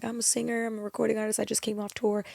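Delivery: lightly voiced timid reply; meek self-quote. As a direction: answer as if unsure of yourself. Her own past answer, quoted.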